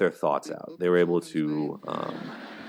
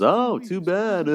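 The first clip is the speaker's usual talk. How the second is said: high, drawn-out mocking laugh-off; mock sympathy; 12 semitones higher, 5 dB louder, much slower than usual. Commentator imitating Ross's jab at Mark, mocking. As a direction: play fake pity in a high, slow voice, then snicker. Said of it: "Ross then says".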